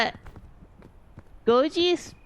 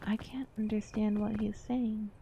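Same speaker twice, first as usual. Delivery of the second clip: whispered; 10 dB quieter than usual. Retold or imitated. Retelling confusion at Newfie accent.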